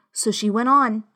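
plain reading voice

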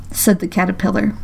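usual speaking voice

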